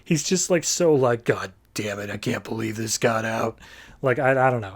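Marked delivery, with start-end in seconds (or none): 1.2-3.6 lower, softer grumbled Batman curse; pissed-off Batman. Retold or imitated. Reviewer voicing Batman fuming over the leak, low grumble. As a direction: mutter it like an annoyed Batman caught out. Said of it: "he looks so pissed off".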